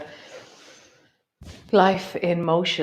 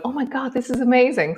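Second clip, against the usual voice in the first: mock amazed gasp; 9 semitones higher, faster than usual.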